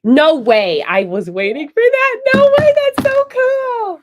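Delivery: high, loud, delighted outburst; thrilled surprise. Real, not acted. Host reacts to grandmother attending Buff State, thrilled. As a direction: squeal it out, stretching the final words.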